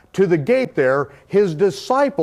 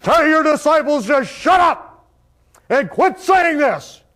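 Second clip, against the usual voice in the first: high, raised, slowing demand; priests demanding; 8 semitones higher than usual. Retold or imitated. Priests telling Yahshua to silence his disciples, a high indignant demand. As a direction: order him to silence them. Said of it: "and they said".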